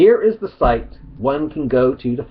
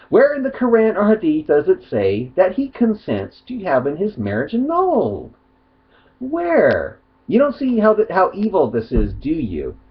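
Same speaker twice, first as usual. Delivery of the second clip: indignant; 8 semitones higher than usual. Real, not acted. Heated rebuttal to critic.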